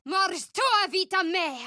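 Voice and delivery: high, solemn spell cry; spell incantation. Acted. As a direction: intone it as a death sentence. Casting a spell in combat.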